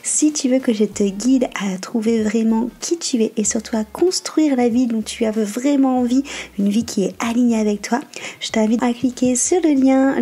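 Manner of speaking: loud, projected self-promo read; podcast ad read. Acted. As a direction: sell it to the listener, loud and punchy. Petite page de pub.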